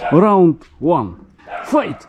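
slow booming round call; fighting game announcer. Pretend. Mock fight start.

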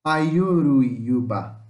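Ayuruyuba said very slowly, vowels gliding together in one breath; slow demo pronunciation. Shown as a demonstration. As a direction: stretch out Ayuruyuba slowly, all vowels joined. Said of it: "Vamos pronunciar agora?". (pronúncia lenta de Ayuruyuba, vogais juntas)

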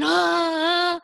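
high, loud gasp of horror; loud high shocked ah; shocked cry. Acted.

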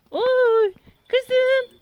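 high, loud fond cry to her dog; cooing. Real, not acted. Fussing over her dog. (köpeğine yüksek sesle sevgiyle seslenme)

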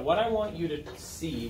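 ordinary delivery

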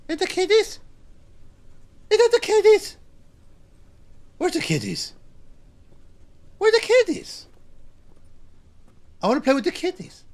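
put-on high-pitched little-kid voice; playful baby talk. Player pretending to seek kitties, cutesy baby talk. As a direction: pipe up high like a pretend toddler. Plays a child wanting the kitties.

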